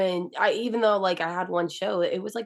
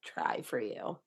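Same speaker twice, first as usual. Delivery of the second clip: whisper; 9 semitones lower, 10 dB quieter, slower than usual. Real, not acted. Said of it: "whisper heard".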